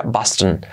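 Boston said BAS-ton, first vowel open like ah, stress up front; Boston with an American open a; Boston in English. Shown as a demonstration. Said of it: "fijaos cómo se pronuncia".

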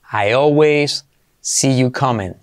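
drawn out for learners, word by word; slow. Shown as a demonstration. Teacher to students, slow model sentence. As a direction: say it slowly so students follow. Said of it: "slow model then normal".

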